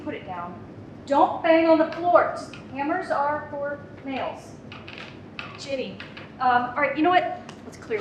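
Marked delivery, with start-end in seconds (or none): 1.1-2.3 put-on slower, louder warning to a toddler; firm scold. Mom scolding her toddler for banging a hammer. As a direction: stop the child firmly.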